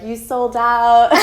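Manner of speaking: slow, loud mock accusation; accusing. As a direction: drag it out like a scolding old friend. Old friends accusing her.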